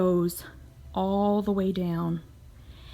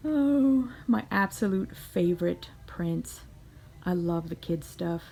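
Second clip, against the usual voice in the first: usual speaking voice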